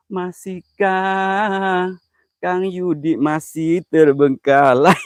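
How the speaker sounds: very slow, stretched syllables; slow mocking drawl at a viewer; drawn-out tease. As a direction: drag every word out teasingly. Teasing Kang Yudi on stream.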